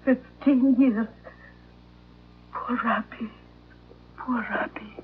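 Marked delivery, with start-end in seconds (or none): 4.2-5.0 hushed whisper of pity; breathless whispered sorrow. Acted. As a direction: whisper it sadly under your breath. Dog about to be put down.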